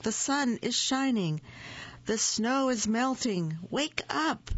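high-pitched eager announcement; crying out. A narrator's reading. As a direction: call excitedly to a sleeper, rising on the end. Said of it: "cried Frog".

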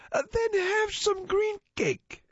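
very high, slow alien. Acted. Alien offers cake.

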